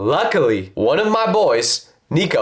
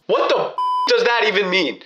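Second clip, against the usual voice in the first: a hint of a baffled man; 6 semitones higher than usual. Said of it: "he goes".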